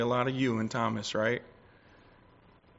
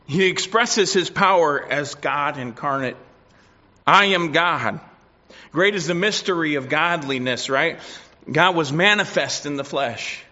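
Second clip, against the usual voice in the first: louder pulpit proclamation; preaching at full force; 2 semitones higher, 6 dB louder than usual. Acted. Preacher proclaiming God incarnate to the church, louder. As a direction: ring it out over the pews.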